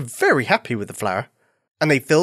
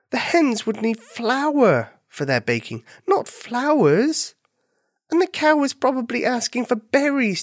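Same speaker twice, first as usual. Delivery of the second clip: higher-pitched, kindly correcting voice; gentle rabbit friend; 8 semitones higher than usual. Acted. Rabbit correcting her confused bunny friend, gentle. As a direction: explain the mix-up patiently, stressing the key words.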